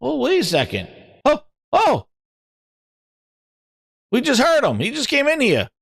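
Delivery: high, hyped-up shouting; excited. Pretend. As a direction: hype up his big entrance. Hyping Cerny's entrance.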